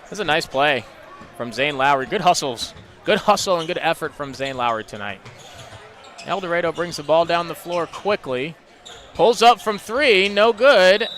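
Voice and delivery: energetic live game call, building on shots; play-by-play. Acted. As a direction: call each move as it happens.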